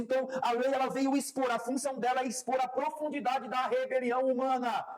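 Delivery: high-pitched, insistent sermon delivery; fervent preaching. Real, not acted. Preacher explaining the law to the church, fervent preaching. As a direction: press each phrase on the congregation.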